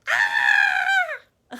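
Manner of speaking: high shrill imitated goat scream; loud mock goat bleat scream. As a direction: belt out a baby's goat-like yell. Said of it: "She just goes".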